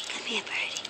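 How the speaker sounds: breathy whispered call to a bird; whispered coaxing. Real, not acted. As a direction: whisper to lure the bird over.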